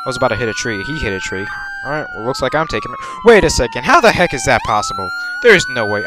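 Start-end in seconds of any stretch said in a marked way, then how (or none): 3.2-6.0 loud, high, fast; stunned that it happened; shocked disbelief. Real, not acted. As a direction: blurt it out in shock. Reacts to impossible game result.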